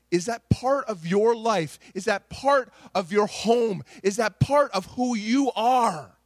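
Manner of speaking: slow, pressing questions that lean on "part"; repeated stressed question. Acted. Preacher challenging his congregation, slow with "part" stressed. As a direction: press "part" each time, slow and searching.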